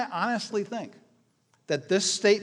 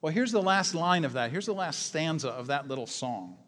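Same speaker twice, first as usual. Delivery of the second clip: normal talking voice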